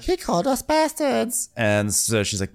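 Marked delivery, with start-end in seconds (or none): 0.0-1.5 put-on much higher child's tattling whine; boy complaining to his mother, high-pitched; whiny boy. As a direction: complain like a hurt kid. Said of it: "Jace is like".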